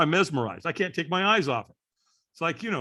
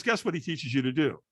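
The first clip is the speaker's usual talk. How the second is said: ordinary talk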